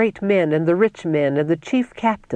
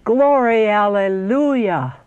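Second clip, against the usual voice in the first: raised, long-drawn shout of praise; exultant cry; 3 semitones higher, slower than usual. A narrator's reading. Saints in the cloud, exultant drawn-out cry. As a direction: start high, then stretch the final word. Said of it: "the saints in the cloud cried".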